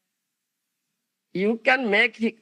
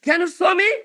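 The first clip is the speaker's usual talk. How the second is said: higher, pointed challenge; skeptic challenge; 6 semitones higher than usual. Retold or imitated. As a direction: dare him to show it. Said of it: "the next question you'll ask".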